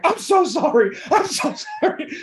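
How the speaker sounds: high-pitched, hammed-up frantic apology; squeaky, over-the-top begging for forgiveness; profuse mock apology. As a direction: pitch way up, pleading in mock panic with a laugh breaking in. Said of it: "profusely apologizing".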